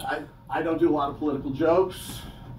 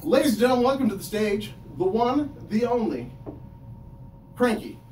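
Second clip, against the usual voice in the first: announcer intro; 6 semitones higher than usual. Pretend. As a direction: hype the next act. Introducing himself like emcee.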